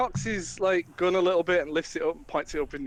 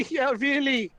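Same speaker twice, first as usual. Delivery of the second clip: high, laughing sarcasm; mocking the messengers with laughter; 10 semitones higher than usual. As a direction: scoff at them through laughter. Flynn mocks their request.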